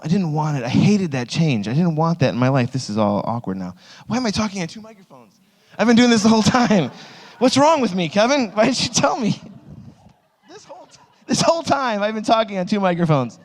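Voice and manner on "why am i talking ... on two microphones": high-pitched comic dismay; incredulous. Real, not acted. Speaker to Kevin about two microphones, incredulous. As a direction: complain in pitched-up disbelief.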